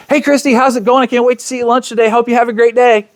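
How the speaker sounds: cheerful voice-text demo, pitched well up; friendly voice message. Shown as a demonstration. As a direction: sound thrilled to be meeting her for lunch. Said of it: "you hear the voice and you hear the tone".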